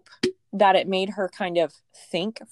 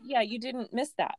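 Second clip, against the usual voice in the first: usual speaking voice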